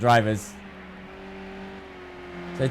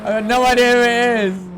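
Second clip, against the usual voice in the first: louder, higher, exasperated; 6 semitones higher, 5 dB louder than usual. Real, not acted. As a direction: throw your hands up. Puzzled streamer, repeated louder.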